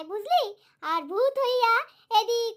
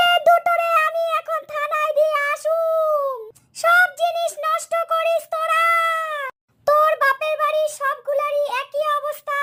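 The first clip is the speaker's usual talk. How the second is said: higher, louder furious scolding; angry threat; 4 semitones higher, 5 dB louder than usual. Real, not acted. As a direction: threaten them with the police station. Aunt threatens kids with police.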